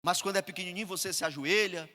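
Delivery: ordinary talk, nothing marked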